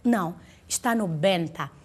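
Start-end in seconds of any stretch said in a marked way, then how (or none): 1.1-1.7 "benta" set apart as the strong syllable; stressed BENTA. Shown as a demonstration. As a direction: lean on BEN in "benta", then let it fall. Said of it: "Onde é que tá a sílaba forte?".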